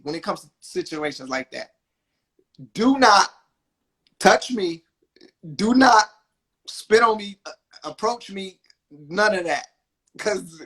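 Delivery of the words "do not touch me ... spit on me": loud, separate beats with DO NOT punched; emphatic warning. Retold or imitated. Husband warning a drunk stranger off, loud and emphatic. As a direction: hammer DO NOT, pausing before each forbidden act. Lays down limits to an aggressor.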